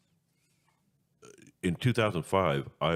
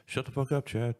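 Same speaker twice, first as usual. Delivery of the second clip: higher-pitched mock heckle; imitating annoyed listeners; 8 semitones higher than usual. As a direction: voice a listener cutting the host off, pitched up. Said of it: "Others are like".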